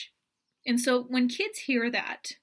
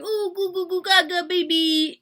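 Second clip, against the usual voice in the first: put-on high, louder cooing at a baby; baby talk; 6 semitones higher, 4 dB louder than usual. Shown as a demonstration. Parent cooing into a baby's face. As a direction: coo up high like to a newborn. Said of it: "parent talk".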